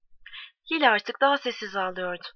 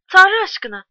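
loud, high exclamation of impatience; acted exasperation, pitched up and loud; exasperated plea; 7 semitones higher, 11 dB louder than usual. A narrator's reading. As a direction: burst out louder and higher, urging her. Mother urging daughter to drink.

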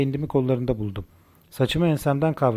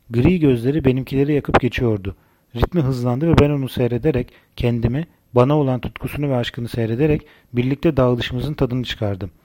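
ordinary delivery

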